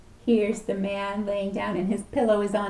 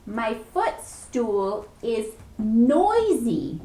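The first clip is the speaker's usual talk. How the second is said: slow complaint; much slower than usual. Acted. Man complains to store clerk.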